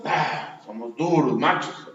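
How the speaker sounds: low gruff mock macho voice; mock tough macho. Pastor mocking men who act tough, in a low gruff macho voice. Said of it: "Los hombres aparentamos".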